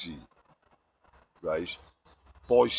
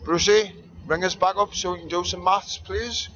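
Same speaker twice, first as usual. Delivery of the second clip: calling out; 7 semitones higher than usual. Real, not acted. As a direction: hail your mate from across the room. Calling out to absent friend.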